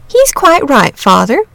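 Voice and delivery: sensible mother. A narrator's reading. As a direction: side gently with the boy.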